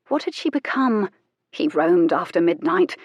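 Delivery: usual speaking voice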